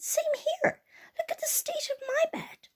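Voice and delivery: very high-pitched mother bear; Mummy Bear voice. Acted. Mummy Bear sees her bed, high voice.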